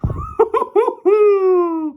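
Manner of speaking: high burst of laughter; loud gleeful laugh; delighted laugh. Real, not acted. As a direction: crack up at the play. Laughs while watching a play.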